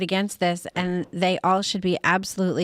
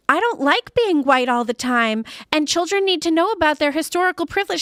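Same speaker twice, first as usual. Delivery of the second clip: mocking quote; 8 semitones higher, 4 dB louder than usual.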